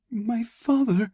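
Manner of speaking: very high, slow, frightened attempt; drawn-out, fearful appeal; tentative plea. A narrator's reading. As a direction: try pleading in a small high voice. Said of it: "Ilyana tried".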